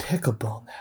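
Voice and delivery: whispered, defeated lament. Acted. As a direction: mourn it under your breath. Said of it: "he's just lamenting the state of baseball".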